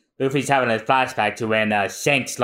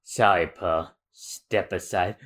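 cold pirate; 5 semitones lower than usual. Acted. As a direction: push him aside without feeling. Voicing Gin coldly.

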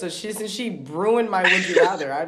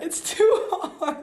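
much higher, slow, laughing through it; 13 semitones higher, much slower than usual. Real, not acted. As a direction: tease her through a laugh. Teasing her friend.

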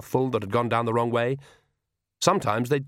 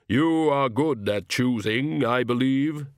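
Death voice; slower than usual. Acted. Death speaks to Granny.

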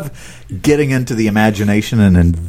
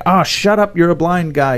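dismissive scoff; 6 semitones higher than usual. Retold or imitated. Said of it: "This blind guy".

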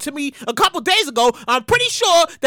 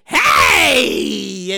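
sudden wild shout; untamed shout; 9 semitones lower than usual. Retold or imitated. Showing listeners his untamed podcast self, sudden shout. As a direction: let your real self out in one burst. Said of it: "Loud, untamed".

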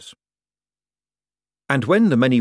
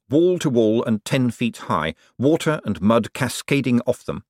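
ordinary delivery

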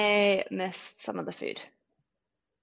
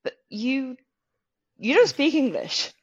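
shocked exclaiming; 5 semitones higher, 7 dB louder, slower than usual. Retold or imitated. Retold quote, loud high.

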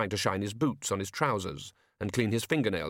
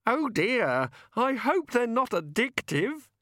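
very high, slow, fretful voice; worried fairy; 3 dB louder, slower than usual. A narrator's reading. Cheerful fairy worrying about the pills, fretful and high. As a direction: fret in a high little fairy voice.

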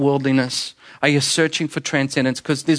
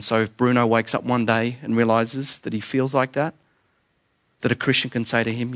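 ordinary talk

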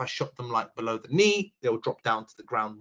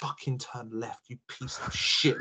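muttered insult; 7 dB quieter, slower than usual. Retold or imitated. Muttering at bad player.

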